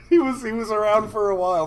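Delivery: loud, high voice breaking into laughter; laughing while telling. Real, not acted. Retelling the buddy's spying, laughing loudly through it.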